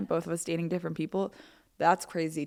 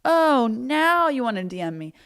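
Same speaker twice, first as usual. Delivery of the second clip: teasing callout, pitched up high; mock indignation; 7 semitones higher, 6 dB louder than usual. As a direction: play up a loud, pointed 'oh, now?'. Mock reaction to a message.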